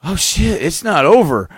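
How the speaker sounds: high, loud, startled outburst; retold panicky discovery; sudden realization. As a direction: blurt it as it suddenly dawns on you. Acts out his sudden realization.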